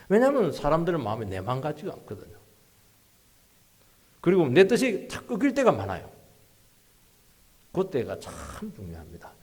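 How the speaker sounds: usual speaking voice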